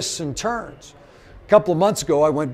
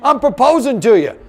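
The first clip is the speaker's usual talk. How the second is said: high and loud, insisting it's real; excited insistence; 8 semitones higher, 8 dB louder than usual. Retold or imitated. Telling his girlfriend he's really proposing, high and loud. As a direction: push past her laugh, I'm serious. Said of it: "I went".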